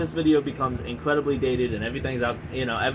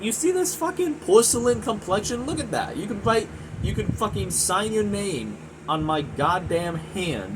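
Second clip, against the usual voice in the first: incredulous high rant; 6 semitones higher than usual. Real, not acted. Mocking his own pale skin.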